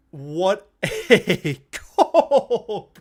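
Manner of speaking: slow, stretched, laughing through it; can't believe the goal; laughing disbelief. Real, not acted. Streamer to chat after the goal, laughing in awe.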